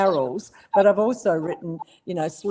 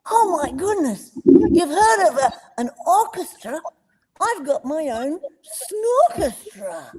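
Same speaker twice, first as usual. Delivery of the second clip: much higher, delighted cartoon koala voice; Blinky Bill voice; 10 semitones higher, 4 dB louder than usual. Voice actor recalling Blinky's snorchestra joke, high delighted koala voice. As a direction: show off a silly joke like a proud young koala. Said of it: "and he goes".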